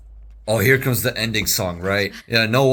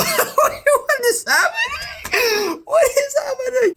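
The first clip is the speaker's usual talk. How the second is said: very high squealed disbelief, laughing; overwhelmed; slower than usual. Real, not acted. Viewer overwhelmed by the animation, squealing in disbelief. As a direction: squeal as if losing it.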